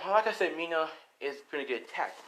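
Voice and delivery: ordinary delivery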